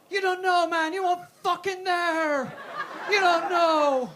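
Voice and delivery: loud, high yell with drawn-out words; traumatised shouting. Acted. Comic recalling bank holiday deli horrors, anguished shout. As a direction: yell it like a haunted war veteran.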